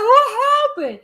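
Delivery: high, loud mock surprise; baffled outcry. Speaker mimics viewers puzzled by how cases go, baffled. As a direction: cry it out in baffled disbelief.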